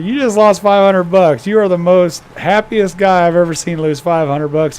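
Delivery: loud, high astonished exclamation; incredulous bartender. Acted. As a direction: marvel loudly at the cheerful loser. Bartender astonished at happy loser.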